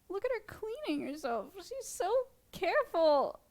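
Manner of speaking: hushed, high adoring coo. Real, not acted. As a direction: coo in awe at a tiny creature. Cooing at the bug cleaning.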